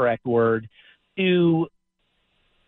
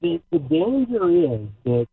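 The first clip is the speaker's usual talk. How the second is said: muffled, like talking underwater; bad phone line, muffled; 3 semitones higher than usual. Real, not acted. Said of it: "You sound like you're underwater and muffled".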